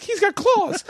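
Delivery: very high, laughing panic; panicked realization. Retold or imitated. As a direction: squeal it with a laugh. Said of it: "I was like".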